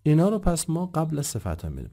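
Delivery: ordinary talk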